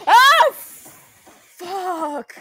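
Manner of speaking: high, loud cry then drawn-out swear; real startled scream; terrified yelp. Real, not acted. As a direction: yelp at a scare. Jump scare in horror game.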